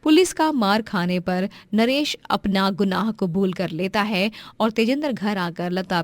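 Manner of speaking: ordinary delivery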